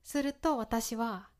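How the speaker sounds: normal talking voice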